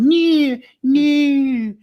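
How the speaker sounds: squeaky high no no no; high whiny refusal. Pretend. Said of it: "him rolling on the ground".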